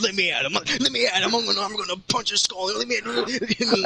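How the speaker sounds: high, hammed-up hothead rant; mock fighting fury. Knuckles raring to punch someone, mock fury. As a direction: strain to get at him, comically riled.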